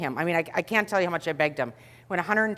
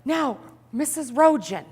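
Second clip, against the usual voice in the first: cheerful trainee; 7 semitones higher than usual. Retold or imitated. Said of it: "he says".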